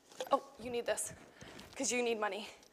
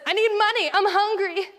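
loud, high plea for help; begging man; 7 semitones higher, 10 dB louder than usual. Acted. Teacher voicing the lame man for children to repeat, begging. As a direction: call out like a hammed-up beggar. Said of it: "our man, he says".

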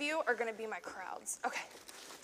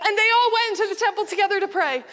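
high, loud, laughing narration; excited storytelling; 8 semitones higher, 11 dB louder than usual. A narrator's reading. Teacher leading children through the story's happy ending, loud excitement. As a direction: sweep everyone along joyfully. Joyful climax of acted Bible story.

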